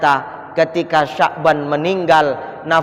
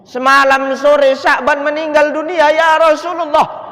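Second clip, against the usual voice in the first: high, loud grieving wail; anguished cry; 9 semitones higher, 3 dB louder than usual. Acted. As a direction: cry the news out to the Prophet. Widow repeats the death news.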